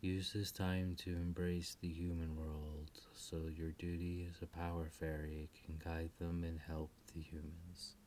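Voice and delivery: soft, low loving mother; tender farewell. Acted. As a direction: gently send them off. Queen Selena farewell to daughters.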